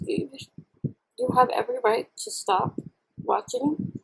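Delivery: ordinary talk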